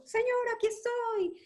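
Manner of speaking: very high, loud call; crying out. Acted. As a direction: make yourself heard by the Lord. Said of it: "Bartimeo se hizo sentir".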